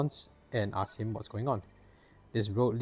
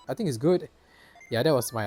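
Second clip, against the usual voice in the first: ordinary talk, nothing marked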